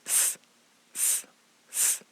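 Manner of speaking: "S" as a bare hissed sss, not the letter name; soft, drawn-out "S" sound, voiceless; isolated s sound. Shown as a demonstration. Teacher demonstrating the shared sound, "S" hissed softly. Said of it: "con el mismo sonido".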